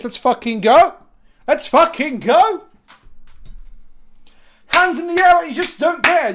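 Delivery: loud, high hype-up; rally the crowd with a big yell; hype shout. Real, not acted. Hyping up stream audience.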